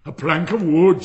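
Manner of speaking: snatch at the words like a prize; greedy exclamation. Acted. Miller seizes on the plank.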